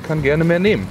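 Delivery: usual speaking voice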